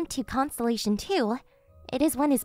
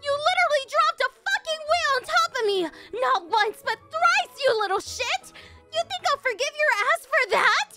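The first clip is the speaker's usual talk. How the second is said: high, squealing outrage; furious rant; 10 semitones higher than usual. Acted. Victim berating the attacker, high furious rant. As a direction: rage through every grievance in a high voice.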